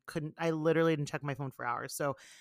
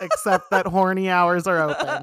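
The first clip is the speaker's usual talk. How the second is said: laughing; 9 dB louder than usual. Real, not acted. Joking about explicit version.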